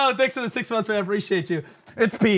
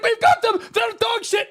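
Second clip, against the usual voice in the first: high, loud gloating shout; cocky mock yell; 13 semitones higher, 3 dB louder than usual. Streamer mocks his shot caller gloating at the enemy, yelling. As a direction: crow over the enemy loudly. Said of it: "He's like".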